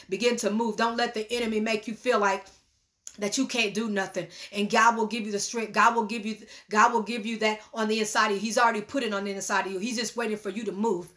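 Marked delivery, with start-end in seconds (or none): none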